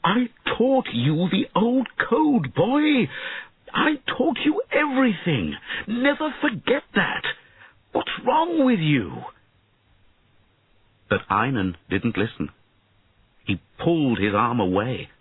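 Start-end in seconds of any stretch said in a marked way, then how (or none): 0.0-9.2 very high, exasperated cry; impassioned cry. A narrator's reading. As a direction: cry out to a pupil who has strayed. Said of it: "and cried".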